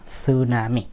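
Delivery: সুনামি said softly and slowly from the s; silent T. Shown as a demonstration. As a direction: model সুনামি with no t sound at the start. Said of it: "টি এর উচ্চারণটা ওরা করে না".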